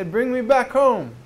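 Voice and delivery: a reed flute crying to go home. A narrator's reading. Preacher voicing the reed crying for its homeland, pleading. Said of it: "And they cry, they say".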